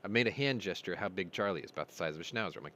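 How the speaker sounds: normal talking voice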